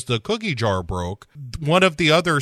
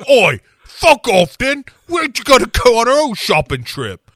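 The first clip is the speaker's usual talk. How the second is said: mock Cockney shout; 10 semitones higher, 7 dB louder than usual. Spice Girls telling off the photographer, loud mock Cockney. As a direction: yell it like a bratty British pop star. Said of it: "they're like".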